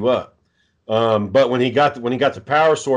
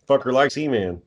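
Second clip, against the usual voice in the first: normal talking voice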